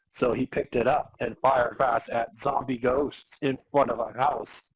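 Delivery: ordinary delivery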